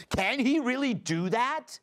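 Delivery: high-pitched mock-alarmed question; half-shouted mock outrage, pitched high. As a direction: imitate a startled, shouting media. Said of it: "the media wakes up and shouts".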